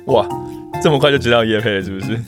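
normal talking voice

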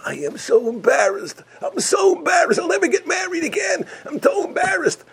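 very high wailing cry of shame; bride's anguished wail. Acted. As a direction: wail in disgrace. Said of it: "the kala gives a scream".

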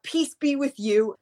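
high, drawn-out imitation of yelling; mock yelling. Angry gym member yelling the church greeting, mock yell. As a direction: draw it out high like a mad man. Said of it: "yelling about".

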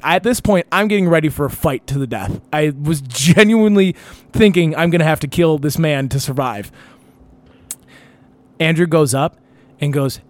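normal talking voice

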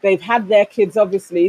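usual speaking voice

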